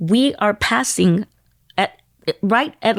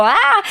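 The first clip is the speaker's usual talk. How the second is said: high, loud mock scream; retold scream; 13 semitones higher, 5 dB louder than usual. Singer showing how she screamed at fans. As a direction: let out a short excited shriek. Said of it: "they see me and scream".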